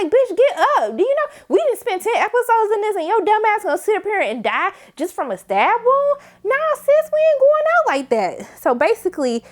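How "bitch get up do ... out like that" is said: pleading yell at screen. Retold or imitated. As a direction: beg her to get up, rising higher. Yelling at dying character.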